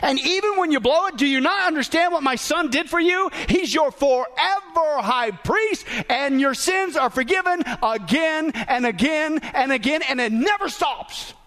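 high, impassioned preaching voice; God's emphatic promise. Acted. Preacher as God assuring his child of forgiveness, impassioned. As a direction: proclaim it insistently, pitch held up, key words stretched. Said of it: "And so here God says".

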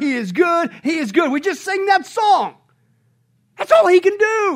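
loud, high, ringing sermon climax; full-force preaching. Acted. Pastor thundering to his congregation about God's unchanging goodness, loud and soaring. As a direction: preach it out to the rafters.

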